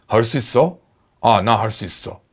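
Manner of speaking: low-pitched Korean register. Shown as a demonstration. Teacher shows Korean is a low language, speaking low. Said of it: "韓国語は低い言語、".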